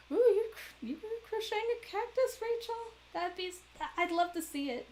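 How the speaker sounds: very high cooing pet talk. Real, not acted. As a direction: coo at her sweetly and high. Cooing to Rachel.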